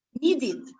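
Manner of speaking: "needed" with -ed as a separate 'id' syllable; final -id. Shown as a demonstration. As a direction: model the 'id' ending on "needed". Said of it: "la pronunciación va a ser id". ("needed" con la sílaba final 'id')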